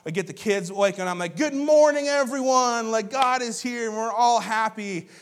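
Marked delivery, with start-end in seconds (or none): 1.4-5.1 high, loud, exuberant wake-up call; cheerful morning greeting. Retold or imitated. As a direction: sing out a happy greeting to everyone. Acting out his cheerful morning greeting.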